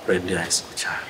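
barely voiced, low and faint; almost silent monk. Monk answering the angry father almost silently, low and faint. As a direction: mouth the words so they're barely heard. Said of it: "Vio que se movió la boquita".

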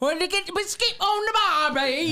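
high, hammed-up British accent. Shown as a demonstration. Host showing off accent work to friends, mock British accent. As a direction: put on a cartoonish British accent. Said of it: "That's my British accent".

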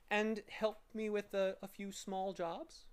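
high, mild coaxing request; polite. A narrator's reading. Gentleman asking help with small jobs, high and polite. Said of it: "he inquired politely".

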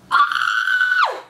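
very high squealed "ah"; delighted scream. Real, not acted. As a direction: shriek with joy at something cute. Screams at the cute outfits.

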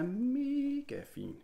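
MEGA stretched long, gliding up high; drawn-out praise. Real, not acted. Dad praising his child's drawing, drawn-out admiration. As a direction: gush with a long rising "mega".

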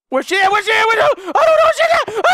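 very high, loud half-laughing yells; frantic search for the monster; panicked laughing shouts. Real, not acted. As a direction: yell while laughing nervously.

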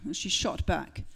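ordinary talk

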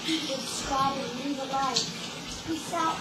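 loud shouted sign-off; shouting. Real, not acted. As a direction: yell the sign-off. Shouted outro.

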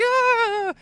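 very high, short loud shriek; victim's scream. Acted. Player caught by the boss, high mock scream. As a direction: shriek as if just grabbed. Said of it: "And you're like".

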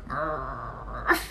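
low rolled growl; angry dog growl. Shown as a demonstration. Teacher quizzing students on the angry dog letter, growling. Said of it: "which letter is the angry dog?".

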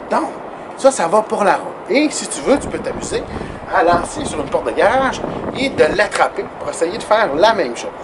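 normal talking voice

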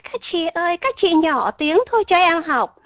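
the overly polite way, pleading; too polite plea. Pretend. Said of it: "Chứ các bạn đừng có quay lại cái".

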